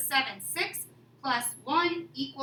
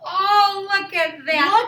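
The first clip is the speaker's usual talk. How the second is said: excited; 5 semitones higher, 7 dB louder, slower than usual. Real, not acted. Excited reaction to domino draw.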